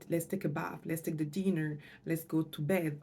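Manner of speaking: ordinary delivery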